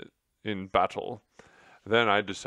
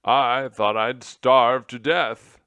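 loud, slow, higher-pitched complaint; moaning; 4 semitones higher, 14 dB louder, slower than usual. A narrator's reading. Rescued general to his friends, loud moaning. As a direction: moan it out with self-pity. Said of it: "he moaned feebly".

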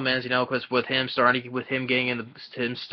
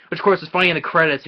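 ordinary delivery

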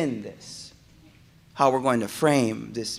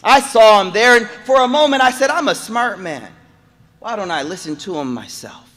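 high, loud impassioned sermon; preaching at full force; 8 semitones higher, 10 dB louder than usual. Acted. Preacher rousing the congregation, high and loud. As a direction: drive it home to the crowd.

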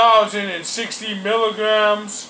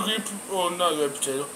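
talking with food in mouth; eating between words; chewing food while talking; 2 semitones lower, 5 dB quieter, faster than usual. Real, not acted. Seen 1: Took bite at -7; jaw keeps working while talking, fork ready.

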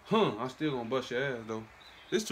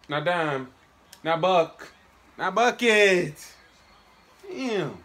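louder, higher, the last word slow; building gamer frustration; frustrated yell; 7 semitones higher, 11 dB louder, much slower than usual. Real, not acted. Yelling at game play.

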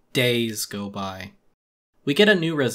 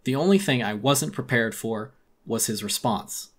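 ordinary delivery

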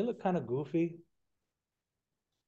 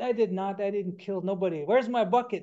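higher and louder, indignant and demanding; angry denial; 6 semitones higher, 6 dB louder than usual. Acted. Coyote furiously denying to his wife, angry raised voice. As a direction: protest hotly, then demand the bucket back.

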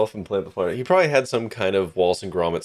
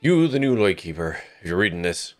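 put-on lightly Newfie-ish old sailor voice; salty old voice. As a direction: read the note as a weathered old lightkeeper. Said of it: "a salty old voice for this".

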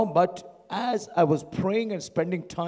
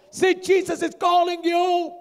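high, raised call with the news; excited calling out; 12 semitones higher, 7 dB louder than usual. Acted. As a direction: call it across to him, pitched up. Disciples call the blind man.

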